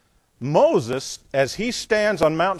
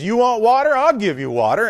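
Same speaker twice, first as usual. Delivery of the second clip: higher, louder sarcastic outburst; angry Moses; 8 semitones higher, 6 dB louder than usual. Retold or imitated. Moses mocking the complaining people, loud and high. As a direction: throw the offer back in their faces. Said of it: "Moses was royally mad at them".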